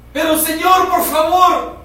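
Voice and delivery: high, loud anguished plea; desperate begging. Acted. Believer begs God to act now, loud desperate plea. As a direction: cry out for mercy.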